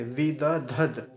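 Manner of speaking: विद धद slowly, each part distinct; slow model. Shown as a demonstration. Teacher modelling विद धद for students. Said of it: "ऐसे".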